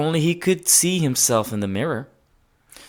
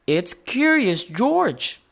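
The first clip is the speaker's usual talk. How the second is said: proud reveal; 8 semitones higher, slower than usual. A narrator's reading. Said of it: "said Betsy".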